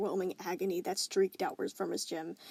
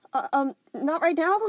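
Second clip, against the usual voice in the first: nervous dodge; 8 semitones higher, 9 dB louder than usual. Acted. Nervously deflecting through door.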